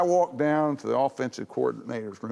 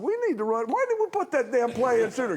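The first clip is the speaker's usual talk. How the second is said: crowing down the phone; excited yell; 9 semitones higher than usual. Retold or imitated. Coach yelling on the phone.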